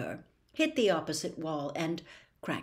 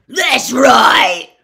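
huge furious roar; 5 semitones higher, 18 dB louder than usual. A narrator's reading. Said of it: "roared Gaunt".

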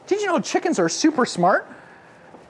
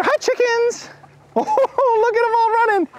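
high delighted calling out; giddy squeal at running birds; excited; 11 semitones higher than usual. Real, not acted. Host chasing ducks, squealing with delight.

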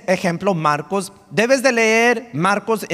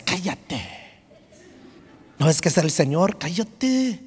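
hushed mock shushing, last one pitched up; scolding church members; 3 semitones lower, 4 dB quieter than usual. Church brothers tell the blind man to shut up, hushed scolding. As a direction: imitate disapproving church folk in a softened voice. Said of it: "ya sabes cómo son los hermanos de la iglesia".

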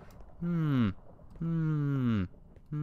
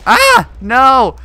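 high loud scream of despair; anguished scream; 14 semitones higher, 18 dB louder than usual. Real, not acted. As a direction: scream as you lose. Dying in the game.

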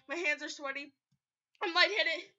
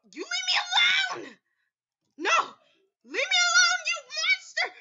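much higher, louder hammed-up scream; 13 semitones higher, 8 dB louder than usual. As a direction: scream at the monster to go away. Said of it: "lightheaded from screaming".

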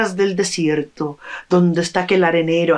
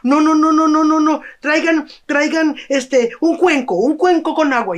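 agitated objection; 8 semitones higher than usual. Acted. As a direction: overrule them in a fluster. Villager objects, demands a bowl. (un vecino alterado exige un cuenco)

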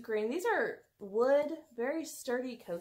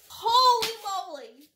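loud, high burst of surprise; excited exclaim; 7 semitones higher, 10 dB louder than usual. Real, not acted. Seeing the Jim Shore box, loud excited exclaim. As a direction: shout it out in amazement. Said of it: "I'm getting so hot".

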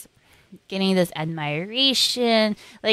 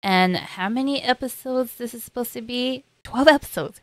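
usual speaking voice

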